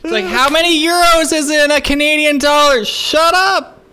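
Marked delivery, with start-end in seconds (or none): 0.2-2.8 shrieked mock tourist question, very high; embarrassingly loud. Mimicking a brother asking about money in public, loud shriek. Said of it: "out loud where the other people can hear". 2.8-3.8 high, frantic comic scolding; exasperated mock yell. Acting out scolding a loud brother, exasperated yell. Retort to embarrassing brother in public.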